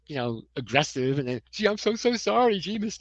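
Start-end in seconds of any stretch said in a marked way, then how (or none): 1.5-3.0 put-on silly gee whiz kid. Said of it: "That really silly gee whiz".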